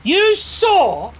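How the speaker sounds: high, loud, slow accusation; accusing knight. Acted. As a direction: draw out the outrage in a high knight voice.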